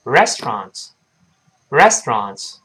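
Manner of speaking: "restaurant" run together as res-tronts; brisk, compressed "restaurant", middle vowel dropped; quick reading. Shown as a demonstration. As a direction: squeeze "restaurant" into two syllables. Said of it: "读快了之后，往往会变成".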